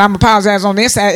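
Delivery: rattled off fast, careless; quick mock excuse. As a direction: rush it like an afterthought. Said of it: "They ain't even thinking about it".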